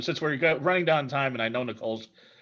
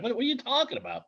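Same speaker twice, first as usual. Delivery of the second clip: confused mock voice; 10 semitones higher, 5 dB quieter, faster than usual. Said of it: "and you're like".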